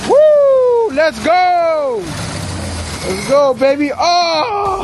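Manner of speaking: excited cheering. Real, not acted. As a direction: celebrate like the engine just roared. Car finally starts.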